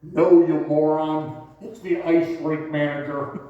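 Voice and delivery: loud, slow exasperated boom; booming annoyed voice. Retold or imitated. Rink manager scolding the fisherman over the loudspeaker, booming. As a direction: bellow it like an annoyed announcer. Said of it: "the voice comes back and says".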